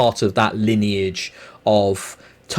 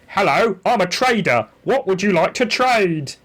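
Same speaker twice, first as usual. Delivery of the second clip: higher, odd cheerful shopkeeper voice; game trader voice; 7 semitones higher than usual. Retold or imitated. Mimicking the game's trader greeting the player, higher put-on voice. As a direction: chirp it like a strange NPC merchant. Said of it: "I really hope that's an imitation of the actual voice".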